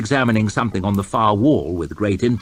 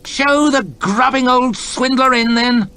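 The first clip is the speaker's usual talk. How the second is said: high, louder exasperated roar; angry roar; 6 dB louder than usual. Acted. Lord chamberlain roaring at the soldiers by the door. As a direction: bellow your frustration. Hoff orders the merchant in.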